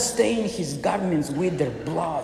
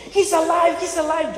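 much higher, jubilant he's alive; joyful worship; 12 semitones higher, 4 dB louder than usual. Acted. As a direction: praise it like worshippers rejoicing. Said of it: "they begin to worship".

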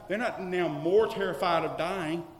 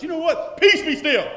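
high, loud TV weatherman bellowing over the storm; a reporter shouting the storm down on air; weatherman command; 11 semitones higher, 14 dB louder than usual. Retold or imitated. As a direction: weatherman shouting peace be still. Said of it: "and he finally goes".

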